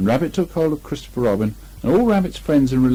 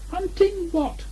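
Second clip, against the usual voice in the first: much higher small Piglet voice; 4 dB quieter, slower than usual. A narrator's reading. Piglet triumphant to Christopher Robin, high and small. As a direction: squeak it out like a tiny piglet.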